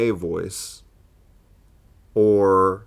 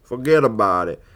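hammed-up New York accent, pitched up in places; 5 dB louder than usual. Comedian demonstrating a character voice, broad New York accent. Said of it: "in a New York accent".

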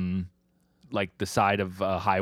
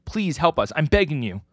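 higher, louder mild plea; pleading; 7 semitones higher, 4 dB louder than usual. Acted. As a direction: plead urgently for help. Wife pleads for child's safety.

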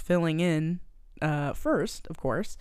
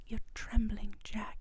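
soft whisper, a little higher; 5 semitones higher, 5 dB quieter than usual. Retold or imitated. Rose whispering to nervous Jack, soft whisper. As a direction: breathe it close to his ear.

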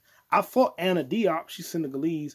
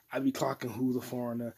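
normal talking voice

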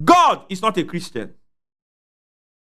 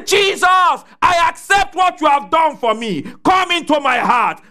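shouted prayer; 8 semitones higher than usual. Pretend. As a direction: cry it out as a new believer. Voicing a believer's prayer.

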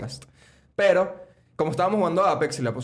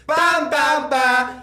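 mock beat shout; 10 semitones higher, 4 dB louder, much slower than usual. Mocking TikTok beat sound.

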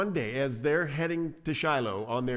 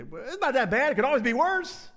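high, loud, squeaky-clueless consolation; hammed-up chipper brush-off of someone's pain; mock counseling; 11 semitones higher, 5 dB louder than usual. Somebody counseling a person going through pain, mock cheer. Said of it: "our default counseling".

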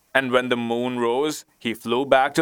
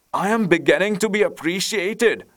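high, animated storybook exclamation; delighted cry; 8 semitones higher than usual. A narrator's reading. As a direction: cry out, thrilled to be recognized. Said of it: "he cried".

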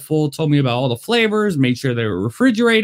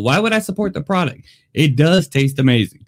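normal talking voice

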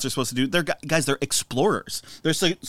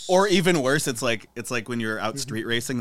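normal talking voice

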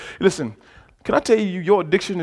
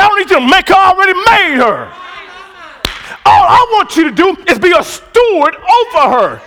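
God's reply; 11 semitones higher, 6 dB louder than usual. Retold or imitated. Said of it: "God said".

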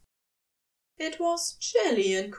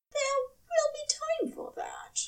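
much higher breathy whisper. A narrator's reading. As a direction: hush it like a promise. Whispered reply in story.

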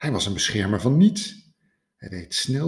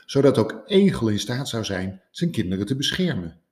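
usual speaking voice

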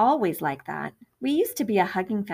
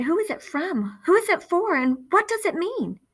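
anxious mother; 10 semitones higher, 4 dB louder than usual. A narrator's reading. Worried mother questions Phoebe.

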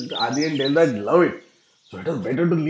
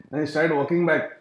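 ordinary talk, nothing marked

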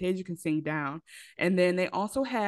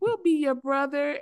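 higher, lightly voiced child; imitating little boys; 8 semitones higher than usual. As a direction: sweetly offer comfort as a little kid. Voices little boys comforting their dad.

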